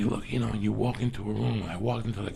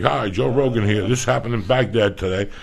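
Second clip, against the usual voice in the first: news anchor voice; 7 dB louder than usual. Retold or imitated. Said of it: "I thought a news guy".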